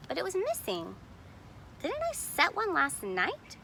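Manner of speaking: a touch of puzzlement; lightly wondering where the trap went; wondering aloud. A narrator's reading. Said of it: "she wondered".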